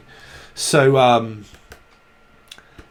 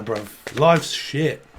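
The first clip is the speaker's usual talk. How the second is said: usual speaking voice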